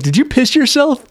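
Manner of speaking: high, incredulous teasing; mock jeer. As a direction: sneer at him in disbelief. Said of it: "And they're like".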